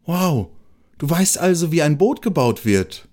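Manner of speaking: high, wide-eyed wonder; eager question. A narrator's reading. The mole asks the squirrel, high with eager wonder. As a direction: ask it up high, amazed and curious. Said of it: "fragte der Maulwurf gespannt".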